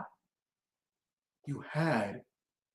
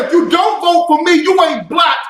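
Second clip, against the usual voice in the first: much higher, shouted quote of a politician; mock shout; 6 semitones higher, 12 dB louder than usual. Quoting the president to voters, shouted mock impression. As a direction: shout it like a campaign boast. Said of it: "He said".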